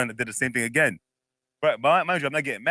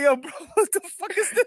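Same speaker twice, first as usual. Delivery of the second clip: much higher, laughing through the words; a retold yelp of confusion; startled disbelief. Retelling friend talking Spanish asleep.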